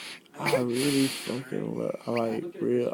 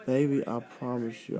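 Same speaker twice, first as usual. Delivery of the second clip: ordinary delivery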